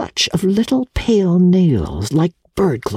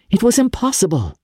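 quick, raised burst of certainty; exclaimed; 8 semitones higher, faster than usual. A narrator's reading. Young man to old lady, exclaiming quickly. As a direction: blurt it out, sure of himself. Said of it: "he exclaimed".

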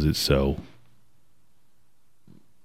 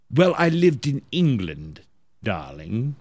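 mock clipped British accent; 3 semitones higher, slower than usual. Host retelling a singer's excuse, mock British accent. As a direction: put on an affected British accent, falling away on the last word. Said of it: "speaking with a clipped British accent".

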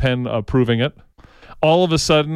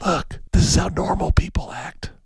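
hushed, breathy aside, mostly unvoiced; a conspiratorial whisper pointing out normal people; mock whisper; 3 semitones higher, 4 dB quieter than usual. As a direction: whisper it like a sly secret. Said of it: "He's like".

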